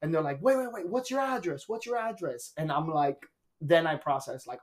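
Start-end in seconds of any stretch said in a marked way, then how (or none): 0.4-2.5 high, soft operator voice; lightly acted high phone voice; imitated emergency operator. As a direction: mimic a dispatcher cutting in, pitched up.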